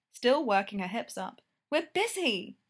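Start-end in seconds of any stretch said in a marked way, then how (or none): 1.7-2.6 curt snap. Acted. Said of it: "Greta snapped".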